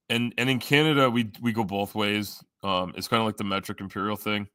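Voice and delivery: usual speaking voice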